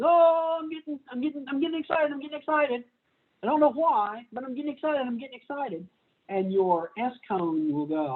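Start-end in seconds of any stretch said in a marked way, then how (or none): 0.0-2.8 high, giddy mock excitement; excited cone. Lecturer voicing an eager cone, high and giddy. 3.4-5.9 mock excitement. L cones voiced as excited.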